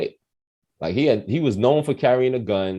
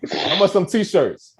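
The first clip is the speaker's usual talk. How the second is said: higher, swaggering baller voice; imitating young self; 6 semitones higher, slower than usual. Teen self ordering at the gas station, higher swagger. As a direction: act like you're really balling.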